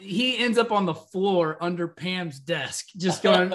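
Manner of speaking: ordinary delivery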